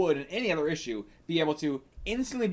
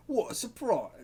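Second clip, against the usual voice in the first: higher-pitched mock British accent; attempted British accent; 8 semitones higher than usual. Reader testing a voice for a comic villain, attempted British accent. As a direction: try a British accent, voice lifted. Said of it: "I can only do a British accent".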